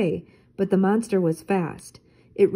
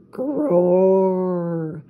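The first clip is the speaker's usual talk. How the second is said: monster growl; 3 dB louder than usual. Acted. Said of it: "came a loud growl".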